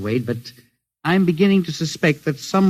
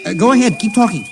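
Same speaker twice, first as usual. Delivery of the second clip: urgent; 6 semitones higher than usual. Acted. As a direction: press him to keep talking. Urging the radio caller.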